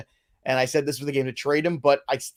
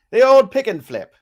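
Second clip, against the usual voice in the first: put-on loud, high old-timey announcer; old-timey newsreel voice; 7 semitones higher, 9 dB louder than usual. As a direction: boom it out like a newsreel. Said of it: "I'd like to do the entire show in that voice".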